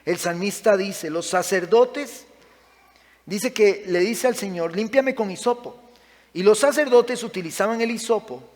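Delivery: loud, high, impassioned sermon delivery; fiery preaching. Acted. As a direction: preach it out with full force to the congregation. Pastor preaching loudly to congregation.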